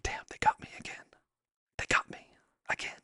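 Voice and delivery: hushed whisper to himself; whispered, private admission of being had; whispered reaction. Retold or imitated. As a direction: whisper it under your breath. Retold private reaction to prank.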